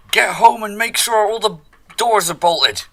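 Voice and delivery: high, loud in-character shout; yelling after someone. Acted. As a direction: call out urgently to someone running away. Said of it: "I yell after him".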